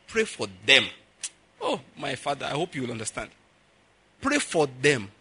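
usual speaking voice

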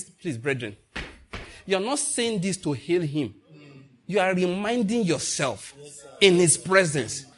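usual speaking voice

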